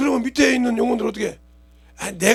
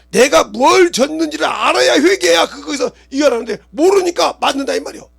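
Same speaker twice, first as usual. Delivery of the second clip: loud, high sermon shout; fiery preaching; 8 semitones higher, 9 dB louder than usual. Acted. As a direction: drive the point home to the congregation. Preaching at full force. (목청 높여 외치는 설교)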